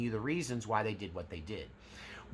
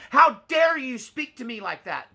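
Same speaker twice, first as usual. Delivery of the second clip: much higher and louder, shrieking indignation; outraged Karen; 13 semitones higher, 10 dB louder than usual. Retold or imitated. Karen scolding someone for criticism, high outraged shriek. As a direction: go sky-high like an entitled customer. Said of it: "the pitch raises until now they're like this".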